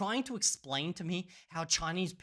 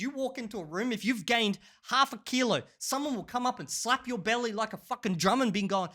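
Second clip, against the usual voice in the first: normal talking voice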